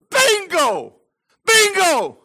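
high, loud triumphant cry; excited shout. Real, not acted. Preacher shouting his discovery to the congregation, excited. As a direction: shout it like you've hit the jackpot.

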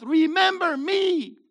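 slow, loud, high shout; preacher shouting Jesus' words to the congregation; shouted climax. Acted. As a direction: hammer home every word. Climax quoting Yeshua.

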